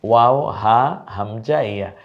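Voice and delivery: slow, drawn-out letter names; slow drill. Shown as a demonstration. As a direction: recite slowly for students to follow. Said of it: "আমি যখন লেখাটা আসে, তার আগে আপনি বলে ফেলুন". (ধীরে ধীরে অক্ষরের নাম)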